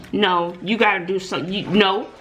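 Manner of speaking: ordinary delivery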